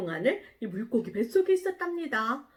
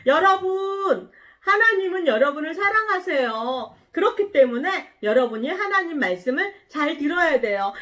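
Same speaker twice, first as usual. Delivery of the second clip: loud, higher call to a crowd; prophet's proclamation; 6 semitones higher, 5 dB louder than usual. Acted. Jonah telling the people of Nineveh, loud proclamation. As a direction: proclaim it out to everyone, voice raised. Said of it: "사람들에게 말해주는 거예요".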